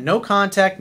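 ordinary talk

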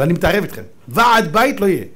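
ordinary talk

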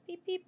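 high little car-horn imitation; two high pretend honks; playful horn beeps. Playing a horn while pushing through.

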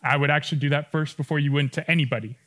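ordinary delivery

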